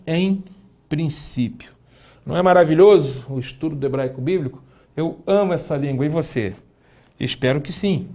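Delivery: usual speaking voice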